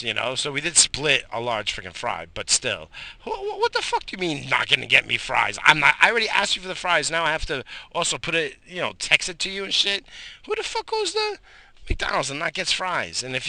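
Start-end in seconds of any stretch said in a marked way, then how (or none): 3.2-13.2 louder, higher exasperated rant. Real, not acted. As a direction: vent the fries outrage. Ranting about the missing fries.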